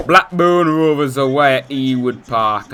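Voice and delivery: loud, drawn-out attempt at a Blackburn accent; botched Blackburn accent. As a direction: put on a badly faked Blackburn accent, slowly and loudly. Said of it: "I don't know what that accent was".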